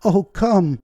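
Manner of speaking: high, loud urgent call. A narrator's reading. Woman urging the guide off the street, calling loudly. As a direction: call him to you urgently. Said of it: "I called".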